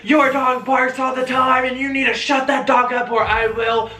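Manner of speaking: high, loud mock yell imitating her; screaming neighbor. Vlogger imitating the woman screaming about the dog, mock scream. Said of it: "And she's screaming".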